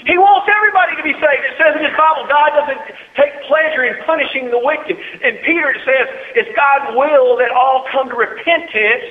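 high, loud revival preaching; exultant shout settling into high, driving sermon; full-force preaching. Real, not acted. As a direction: cry it out to the whole congregation.

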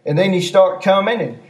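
ordinary delivery

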